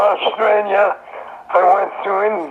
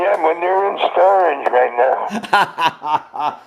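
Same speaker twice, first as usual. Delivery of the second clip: laughing; 2 semitones lower, much slower than usual. Real, not acted. Joking answer through laughter.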